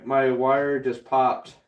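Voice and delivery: usual speaking voice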